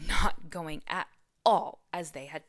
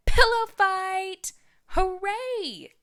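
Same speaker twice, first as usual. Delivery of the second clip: much higher, much louder, slow excited yell; yelling crowd; 14 semitones higher, 10 dB louder, much slower than usual. Acted. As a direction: shout it out like a thrilled audience. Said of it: "yelled the audience".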